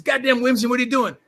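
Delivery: high-pitched, exasperated mock outburst; shocked coach. Coach reacting to his player flattening a teammate, high and exasperated. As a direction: throw up your hands at what he just did.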